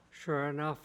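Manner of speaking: plain reading voice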